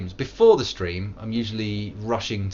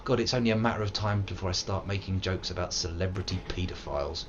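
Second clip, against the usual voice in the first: ordinary talk, nothing marked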